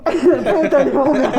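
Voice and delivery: loud laughing exclamation. Real, not acted. Laughing at the club name.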